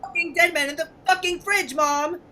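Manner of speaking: put-on teen son screaming back at his mother in outrage; furious yell. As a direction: scream it at your mom.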